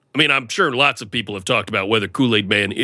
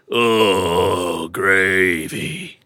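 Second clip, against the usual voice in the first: lower, crying Oh gravy; sad lament; 4 semitones lower, much slower than usual. Retold or imitated. Gloomy Gravy Man lamenting his new filling, crying. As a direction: wail it like a mournful jug. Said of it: "more like".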